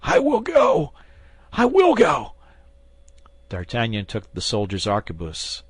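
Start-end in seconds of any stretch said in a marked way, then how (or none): 0.0-2.3 very high, loud panicked promise; terrified agreement. Acted. Terrified bandit promises the swordsman to fetch the letter. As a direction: blurt it out in sheer terror.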